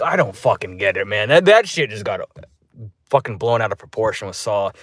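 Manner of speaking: normal talking voice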